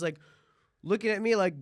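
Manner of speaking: normal talking voice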